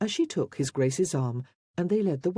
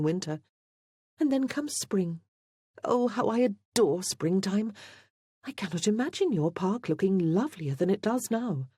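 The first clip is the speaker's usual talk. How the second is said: lightly voiced young woman, pitched higher; earnest, enthusiastic heroine; 4 semitones higher than usual. A narrator's reading. Christine tells the Duke she adores springtime, earnestly enthusiastic. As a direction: let admiration lift the voice.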